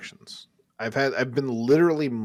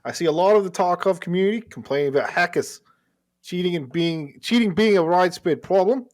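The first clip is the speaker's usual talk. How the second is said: higher, louder Aussie voice; Australian accent; 7 semitones higher, 6 dB louder than usual. Acted. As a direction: read the listener's letter in a broad Australian accent. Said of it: "you do a fucking killer Australian accent".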